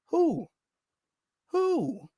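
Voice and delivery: high, soft hooting who; owl-like who. Shown as a demonstration. Vlogger playing dumb at a commentator, hooting like an owl. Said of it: "Get me sounding like an owl".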